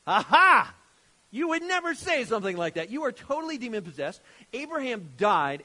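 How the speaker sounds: theatrical accusing shouts; hammed-up exclaiming. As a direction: play an indignant crowd pouncing on proof.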